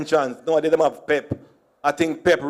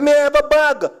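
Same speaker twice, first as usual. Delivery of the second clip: higher, louder repeat; emphatic; 10 semitones higher, 4 dB louder than usual. Retold or imitated.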